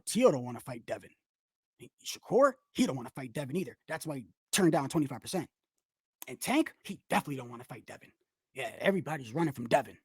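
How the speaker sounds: low, soft, fast mock of a conspiracy claim; mocking psychotic narrative. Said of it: "You sound psychotic when you say".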